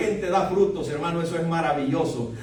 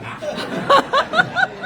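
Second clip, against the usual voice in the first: high laugh. Real, not acted. Laughs at own flat-headed joke.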